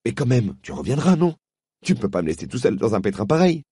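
put-on boy pleading with his friend. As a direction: beg her not to leave. Diggory pleads.

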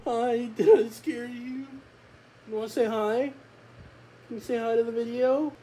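high-pitched pet-talk voice; talking to a dog. Real, not acted. Streamer checking on the scared dog, high pet voice. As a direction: coo it high to a startled pet.

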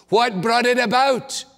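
ordinary talk, nothing marked

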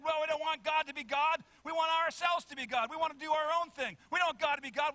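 high, loud protesting shout; mock protest. Protesters rejecting God as king, loud mock protest. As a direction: chant it like an indignant picketer. Acting out protesters with picket signs.

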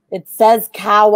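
loud, slow hammed-up yell; mock shout. Mock police shouting at the psychic, slow and loud. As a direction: bellow it like a fed-up cop.

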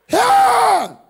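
long, high yell; big, high preacher's yell; shout. Acted. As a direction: holler it to the crowd.

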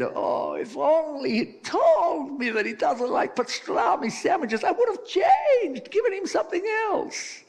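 very high mock-Italian wail; over-the-top Italian grief, high-pitched; Italian wife. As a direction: lament as a hammed-up Italian widow. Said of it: "And the Italian's wife said".

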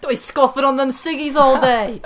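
high, loud British scolding; mock-British slangy nagging; British accent. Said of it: "my favorite British-ism".

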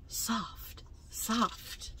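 soft whispered words; gentle unvoiced whisper. A narrator's reading. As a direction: breathe the words out.

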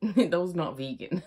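can't stop the giggles; laughing out the line. Real, not acted. Giggling at own burp.

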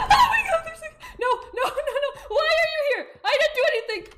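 high frantic panic with laughter; panicked. Real, not acted. Monster appears, panicking.

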